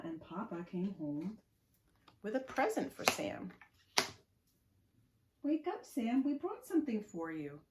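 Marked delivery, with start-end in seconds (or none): 5.4-7.6 put-on parent waking child.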